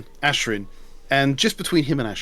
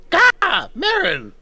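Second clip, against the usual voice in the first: high, loud squawking raven; 3 dB louder, much slower than usual. Acted. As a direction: screech the name like a raven. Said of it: "Poe on his shoulder goes".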